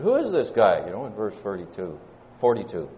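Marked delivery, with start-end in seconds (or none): 0.0-0.8 higher, louder scoffing question; skeptical crowd. Retold or imitated. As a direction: scoff at the local boy from town. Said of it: "They said".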